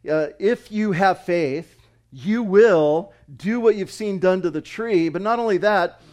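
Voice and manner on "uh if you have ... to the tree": loud, slow, heavily stressed preaching; emphatic. Retold or imitated. Preacher quoting Jesus' promise to the disciples, emphatic. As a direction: lean hard on "you will", drawn out and raised. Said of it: "Jesus uses very emphatic language here".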